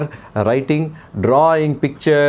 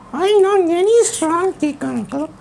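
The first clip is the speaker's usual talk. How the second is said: put-on puppet boy voice; 14 semitones higher than usual. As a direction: play the cheeky little boy puppet. Puppet child voice.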